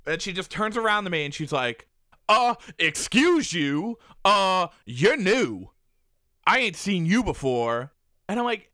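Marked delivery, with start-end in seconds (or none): 2.3-7.9 snooty put-down. Retold or imitated. Rude woman confronts him.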